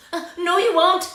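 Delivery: high squeaky protest; squealing pig. Acted. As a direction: squeal it back at the wolf. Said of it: "The little pig squealed".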